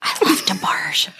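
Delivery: whispered confession; whispering. Real, not acted. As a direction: whisper it like a guilty secret. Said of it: "I'm gonna whisper that".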